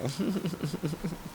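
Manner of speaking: soft, high giggle; self-amused laugh. Real, not acted. Joking about being that influential now, soft self-amused giggle. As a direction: giggle quietly at your own boast.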